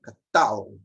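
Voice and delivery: catal with TAL lengthened and strong; stress on last syllable. Shown as a demonstration. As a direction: lean on the final TAL of catal. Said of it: "aqui é a sílaba forte".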